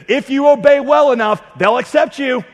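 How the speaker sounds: high, loud preacher's build; preaching at full force. Acted. Preacher warning congregation about false gods, full force. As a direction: drive it home like a revival preacher.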